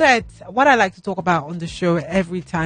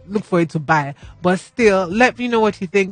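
usual speaking voice

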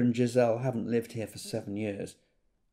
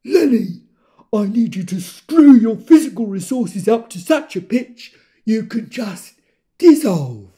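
loud, high, booming luvvie director; sweeping, loud stage voice urging an actor on; grand theatrical director; 9 semitones higher, 16 dB louder than usual. Retold or imitated. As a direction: declaim it like an overblown drama coach. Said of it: "Yolande stopped me and said".

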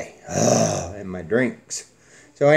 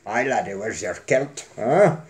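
put-on Scottish accent; 3 semitones lower than usual. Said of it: "think I do a shitty Scotsman accent".

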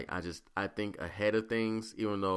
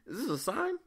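much higher, wondering inner voice; 8 semitones higher than usual. Retold or imitated. Thinking it's a sign from the Lord, high amazed. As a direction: marvel as if hearing from above. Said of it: "In my head, I'm like".

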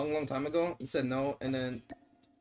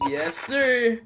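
mock yes sir; 6 semitones higher, 5 dB louder than usual. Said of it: "I deadass went".

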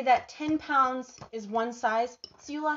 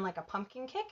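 ordinary talk, nothing marked

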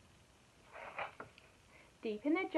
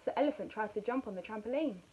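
ordinary delivery

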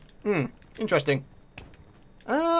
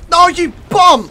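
loud, slowed, higher cry of annoyance; frustrated shout; 6 semitones higher, 10 dB louder, slower than usual. Real, not acted. Gamer losing the match at the end, a loud frustrated shout. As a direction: yell your outrage at the winner.

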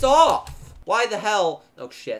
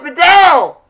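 loud, higher yell of disbelief; frustrated shout; 6 semitones higher, 12 dB louder than usual. Real, not acted. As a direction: scream the player's name in shock. Player misses an open chance.

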